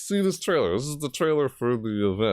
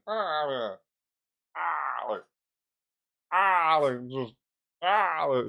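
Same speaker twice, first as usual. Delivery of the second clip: mimicking startled figure; 8 semitones higher, much slower than usual. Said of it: "He's like".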